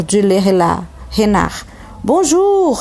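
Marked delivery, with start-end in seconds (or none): 2.0-2.8 bonjour aigu et poli du petit prince; le petit prince répond poliment, voix aiguë. Acted. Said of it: "répondit poliment". (le petit prince salue le renard, poliment)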